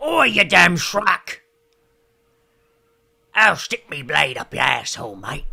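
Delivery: loud slow mock British accent orc. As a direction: ham up a Cockney orc threatening a mate. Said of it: "They talk like this".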